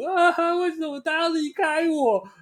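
high, slow hammed-up wail; mock wailing. Heartbroken bar customer crying to friends, mock wail. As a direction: whine it like a dumped drama queen. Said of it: "开始在那边哭".

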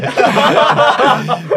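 a big amused laugh; loud laugh. Real, not acted. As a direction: burst out laughing high and loud. Laughing at the cup story. (放声大笑)

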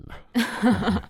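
slow, high, laughing aside; drawn-out joking qualifier with a laugh. Real, not acted. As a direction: stretch it out, chuckling. Joking condition about good scripts.

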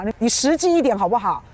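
ordinary delivery

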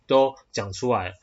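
ordinary talk

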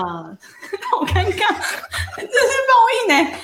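laughing through the words, embarrassed; nervous laugh. Real, not acted. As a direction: giggle it out, caught off guard.

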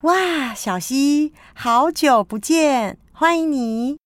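high, slow warm greeting; delighted greeting. Acted. Greeting child at airport.

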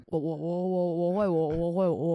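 mild mock drunk stutter; drunk stammer. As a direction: imitate a tipsy friend tripping over words. Drunk friend voiced in story.

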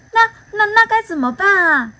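high, startled storybook panic; a hammed-up fright; panicked. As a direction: squeak out the worry. Mouth panics about monster village.

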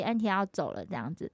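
ordinary delivery

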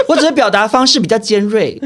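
ordinary talk

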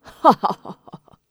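loud mysterious cackle; show-off laugh to impress; booming laugh. A narrator's reading. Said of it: "哈哈大笑". (故弄玄虚地大笑)

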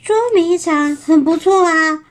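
keen, lifted agreement; eager agreement. Acted. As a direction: chime in keenly with the idea. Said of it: "马上附和说".

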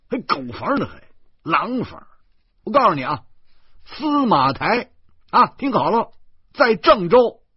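loud, high, exasperated yelling; angry roar. A narrator's reading. Passenger bellows at the clueless driver, furious. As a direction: roar it out, hammering each place name. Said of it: "我怒吼着说".

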